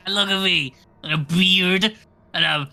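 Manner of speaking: high, loud delighted boasting; gleeful showing off. Acted. Player showing off his new dwarf body, gleeful and excited. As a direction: show off the new body with glee.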